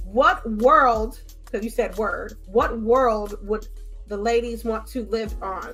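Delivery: ordinary delivery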